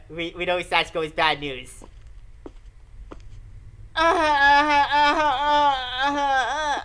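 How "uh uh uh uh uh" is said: high loud fake sobs; loud exaggerated wailing sobs; hammed-up sobbing. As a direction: blubber dramatically. Character breaks down sobbing.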